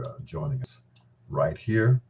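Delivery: ordinary talk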